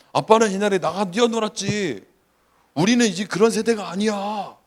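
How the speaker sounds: imitating an indignant kid talking back; teenager's retort. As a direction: argue back at Dad. Voicing his teenage son's retort.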